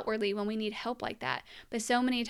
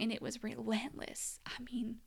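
choked whisper; 8 dB quieter than usual. Real, not acted. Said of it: "I'm getting a little emotional".

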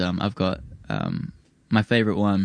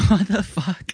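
shocked outburst; 10 semitones higher, slower than usual. Retold or imitated. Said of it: "I'm like".

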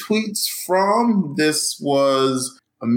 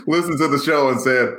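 ordinary talk, nothing marked

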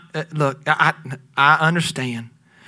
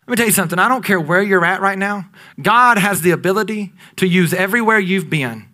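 ordinary delivery

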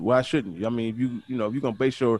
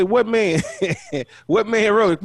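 pointed retort; 7 semitones higher than usual. Retold or imitated. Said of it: "I say".

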